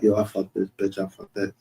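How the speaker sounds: mumbled quiet mock boast; mock bro talk. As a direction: toss it off softly, mocking how they talk. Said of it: "I'm talking like".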